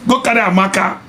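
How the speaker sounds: high-pitched teasing dare; mocking dare. Real, not acted. Vlogger daring men to chase girls, mocking. Mock advice to foolish men.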